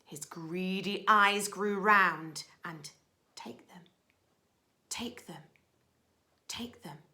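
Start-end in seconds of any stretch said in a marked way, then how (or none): 3.4-7.0 soft hollow echo; a hushed echo luring him in; whispered echo. Acted. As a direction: whisper it back like the cave. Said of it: "came back the welcome sound".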